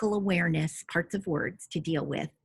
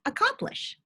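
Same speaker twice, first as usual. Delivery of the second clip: student answer. Retold or imitated. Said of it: "And the student would say".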